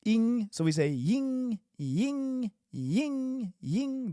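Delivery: ying high, slow and falling; ying drill. Shown as a demonstration. Teacher drilling ying, high and slow. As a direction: sing ying out slowly. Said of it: "I want to really hear the ng".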